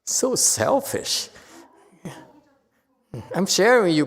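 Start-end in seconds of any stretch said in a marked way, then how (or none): 0.0-1.3 slow, much higher, teasing reproach; mock accusation. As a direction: drag it out like a playful scolding. Mock complaint about the yawning listener.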